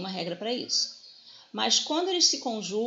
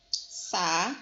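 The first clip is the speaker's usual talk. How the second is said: "Sa-i-da" split into three separate syllables; separado em sílabas; 3 semitones lower than usual. Shown as a demonstration. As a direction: say "Sa-i-da" syllable by syllable, pausing around the lone "i". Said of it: "Se eu for separar a sílaba". ("Sa-i-da" dito sílaba por sílaba, com pausas)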